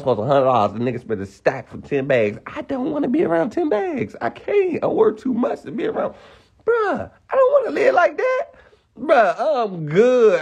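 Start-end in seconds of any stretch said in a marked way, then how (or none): 2.5-10.4 high-pitched comic protest; mock pleading. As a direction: plead in a much higher voice, hamming it up. Mock protest about being around drugs.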